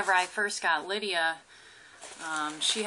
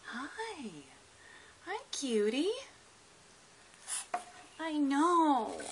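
pet talk; 7 semitones higher, slower than usual. Real, not acted. Cooing at the lizard.

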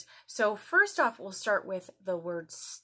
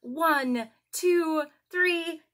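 excited counting; 8 semitones higher, 8 dB louder, slower than usual. Acted. Counting aloud with children.

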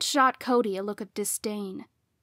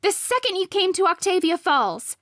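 high, loud accusing rant; heated, angry raised voice; yelled; 9 semitones higher, 6 dB louder than usual. A narrator's reading. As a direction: yell it at your rival. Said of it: "she yelled".

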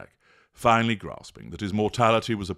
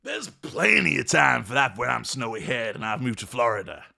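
higher, breezy grin in the voice; grinning; 6 semitones higher, 4 dB louder than usual. Acted. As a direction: toss it off with a big grin. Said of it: "grinned Jack".

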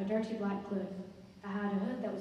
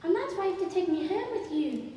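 put-on high, raised child voice; 9 semitones higher, 5 dB louder than usual. Child asking stranger to take it home, insistent.

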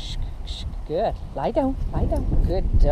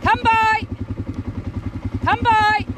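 herding call; 11 semitones higher than usual. Real, not acted.

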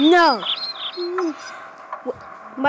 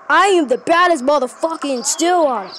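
ordinary talk

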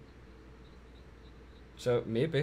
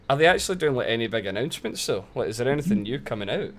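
ordinary talk

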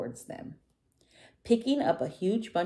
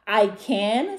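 high, loud, eager little dinosaur; delighted exclamation; 5 semitones higher, 8 dB louder than usual. A narrator's reading. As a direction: burst out with it, pitch climbing. Tiny delighted he can eat.

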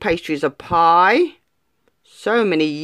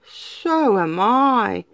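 slow cat; 2 semitones higher, much slower than usual. Acted. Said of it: "meowed the cat".

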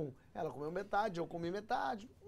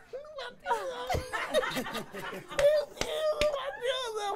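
very high squealed denial, laughing; hammed-up high-pitched giggly wail; stoned wife. Stoned wife insisting to husband it hasn't kicked in, squeaky laughing denial.

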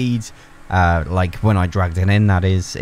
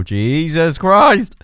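drawn-out, higher cry of shock; startled exclamation; 7 semitones higher, 5 dB louder, much slower than usual. Real, not acted. Gamer caught out while driving, startled exclamation. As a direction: stretch it out slowly in amazement.

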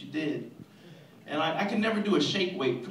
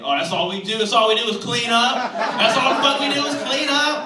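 rising, louder indignant rant; building outrage; 5 semitones higher, 8 dB louder than usual. Acted. Viewers at home outraged at a cleaning ad, building anger. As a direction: get louder each time you repeat it.

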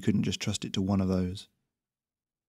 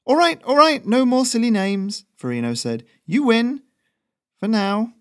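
higher and louder, giving in after the scare; loud flustered surrender; 13 semitones higher, 6 dB louder than usual. Acted. Courier yielding to his goose after the barrel roll, loud and flustered. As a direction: shout it out, rattled but laughing it off.

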